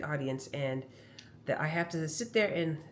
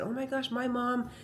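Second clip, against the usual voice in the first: higher-pitched, rushed, flustered complaint; exasperated venting; 7 semitones higher than usual. Retold or imitated. To the massage therapist and kinesiologist about mom at the DMV: flustered venting. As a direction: gush the frustration all at once. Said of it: "and I said".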